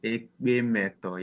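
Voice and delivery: Ekpe Meto said slowly, syllable by syllable; slow model answer. Shown as a demonstration. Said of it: "What's happiness in Ekpe language?".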